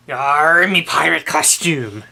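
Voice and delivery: hearty pirate growl; loud pirate talk, arr. Pretend. Showing off pirate costume.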